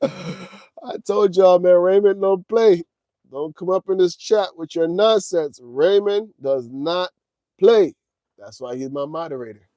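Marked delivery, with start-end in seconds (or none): 0.0-7.9 high, very loud, emphatic warning; gleeful loud boasting. Real, not acted. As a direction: crow it loudly, punching the last words. Crowing about his strict moderator.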